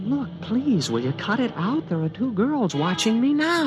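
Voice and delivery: high, exasperated plea. Acted. Student pleads with the invisible alien while girls watch, exasperated and high. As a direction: beg it to stop before people notice. Embarrassed by a pestering voice.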